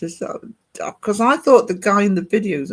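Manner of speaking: ordinary talk, nothing marked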